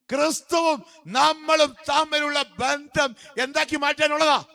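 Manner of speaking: high, loud revival preacher; ringing, high-pitched exhortation; full-force preaching. Real, not acted. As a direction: proclaim it to the whole congregation.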